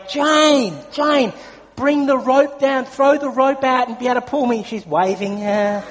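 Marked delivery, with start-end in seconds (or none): 0.1-4.6 high, loud call across the water; calling out desperately. Retold or imitated. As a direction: yell for help to someone far away on a bridge. Calling wife from drifting dinghy.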